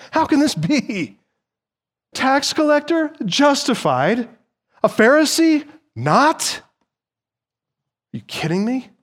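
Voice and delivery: high, shocked disbelief; incredulous crowd. Pretend. Preacher voicing the shocked crowd, incredulous. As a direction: voice the crowd's stunned outrage.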